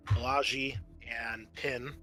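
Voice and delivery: ordinary talk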